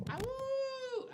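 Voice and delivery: high, long hound dog howl. Pretend. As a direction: throw your head back and howl like a hound. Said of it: "That was more of a, a hound".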